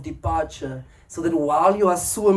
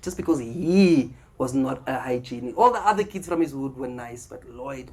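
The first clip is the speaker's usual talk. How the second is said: ordinary talk, nothing marked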